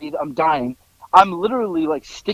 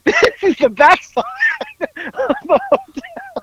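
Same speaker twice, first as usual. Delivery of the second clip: high squawky quote breaking into laughter; very high mock insistence through laughs; laughing imitation. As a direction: imitate the driver, cracking up midway.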